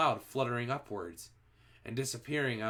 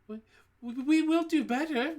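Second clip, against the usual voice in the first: much higher, timid witches' voice; nervous witch audience; 5 dB louder than usual. A narrator's reading. Witches promising their leader, much higher nervous voice. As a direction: stammer a frightened promise. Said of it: "murmured the audience".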